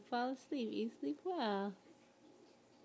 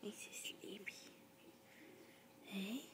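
whisper; 13 dB quieter than usual. Real, not acted. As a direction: whisper it so he stays sleepy. Soothing baby to sleep.

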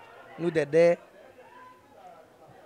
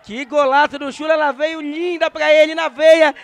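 explosive goal commentary; 7 semitones higher, 9 dB louder than usual. Real, not acted. Goal celebration call.

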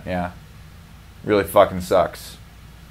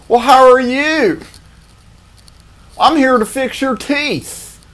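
high, slow, self-assured; hammed-up overconfidence; overconfident dentist; 6 dB louder, slower than usual. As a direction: play a cocky cheerful dentist, pitched way up. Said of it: "he's overly confident like that too".

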